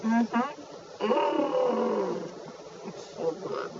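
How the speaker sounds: savoring with mouth full. Real, not acted. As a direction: hum and whisper through a bite. Tasting cookie, mouth full.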